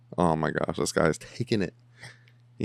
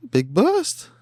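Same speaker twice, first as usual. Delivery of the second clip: much higher, louder excited; mock celebrating voice; squeaky cheer; 13 semitones higher, 5 dB louder than usual.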